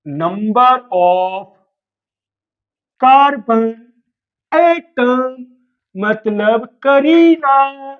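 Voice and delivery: each word stretched out very slowly; teacher dictating very slowly; slow dictation. Shown as a demonstration. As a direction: spell it out slowly for the students to write down. Teacher dictating the key definition.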